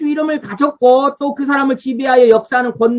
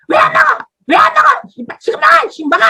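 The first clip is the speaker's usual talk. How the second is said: high, loud mock scolding; angry parent; 4 dB louder than usual. Parent yelling at a child who won't eat, angry. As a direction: act out a parent blowing up. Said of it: "막 화를 내".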